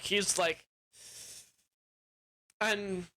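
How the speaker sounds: ordinary talk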